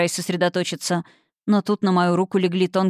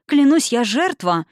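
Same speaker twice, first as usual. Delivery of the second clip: slow, raised-voice insistence; flustered protest; 5 semitones higher, 4 dB louder than usual. A narrator's reading. The succubus defends herself, flustered and insistent. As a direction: swear you are the victim. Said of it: "опомнилась та".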